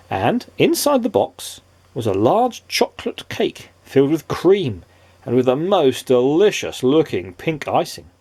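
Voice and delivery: ordinary delivery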